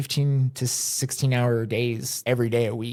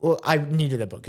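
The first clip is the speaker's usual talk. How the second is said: ordinary talk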